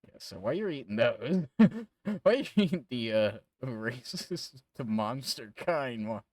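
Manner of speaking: loud laugh through the words. Real, not acted. Laughing at the players eating the merchants, loud. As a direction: laugh out loud while asking.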